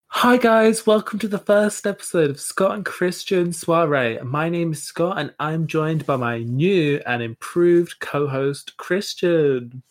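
high, bright-pitched hosting voice; podcast host intro. Acted. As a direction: greet the listeners with put-on cheer.

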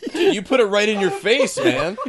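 laughing loudly in disbelief; cracking up at a friend. Real, not acted. Laughing at friend sniffing salts.

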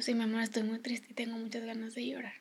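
put-on hushed, tender reply to a child; near-whispered motherly honesty; soft admission. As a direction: confide it very quietly. Voices her answer to sad child.

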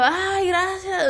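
a crushed fan gasping her thanks; squashed little girl. Retold or imitated. (voz de niña aplastada, lenta y aguda)

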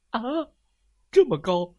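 high, loud disbelieving cry; shocked elephant exclaiming; astonished elephant. Acted. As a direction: gasp at how tall it is. Elephant amazed.

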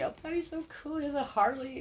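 put-on high, soft swooning girlish voice; mock swooning woman over a biker; smitten girl. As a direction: gush like a lovestruck teenager, up high. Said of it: "we have those butterflies when we meet".

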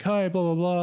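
high, imitated drive-thru greeting; mock over-the-moon server; super ecstatic. Dairy Queen lady greeting customers, imitated super ecstatic. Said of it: "like, super ecstatic".

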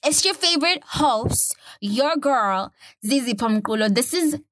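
high, bright-up show-opening announcer voice; podcast host intro. Acted. Host welcoming listeners to her podcast, upbeat announcer. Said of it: "podcast host intro".

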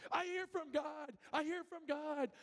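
high and slow, bursting with the news; rushing home to tell his wife, thrilled and high-pitched; excited exclamation. Retold or imitated. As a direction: announce it like you can't contain it.

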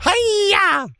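loud high martial arts cry; karate yell. Acted. Miss Piggy striking, loud high karate yell. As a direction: scream it like a karate chop.

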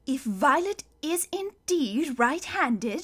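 slow, measured reasoning, slightly high; careful. A narrator's reading. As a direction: weigh each word like a deliberating judge. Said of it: "she said carefully".

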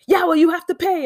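high, pushy impression of an accented man; mock delivery man yell. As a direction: parody the driver brushing off her complaint. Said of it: "he's just like".

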